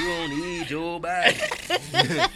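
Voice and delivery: very slow, stretched Southern drawl; country Atlanta drawl. Retold or imitated. Joking on the podcast, mimicking T.I.'s Southern drawl. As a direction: drag out don't like a country rapper. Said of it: "Because T I so country".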